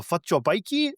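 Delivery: put-on South African accent. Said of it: "I can't do a great South African accent".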